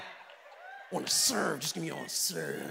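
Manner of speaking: mock desperate volunteer. Pastor mimicking a desperate volunteer, low pleading.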